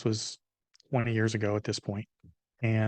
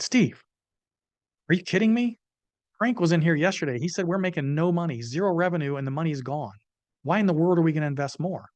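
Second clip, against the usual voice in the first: higher-pitched, acted exasperated disbelief; incredulous protest; 6 semitones higher than usual. To business partner who wants more money, incredulous. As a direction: argue back, pitch pushed up in alarm. Acts out his argument with partner.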